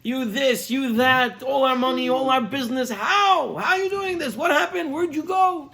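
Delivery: hammed-up wife berating her husband; imitated scolding. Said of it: "she starts giving to him over the head".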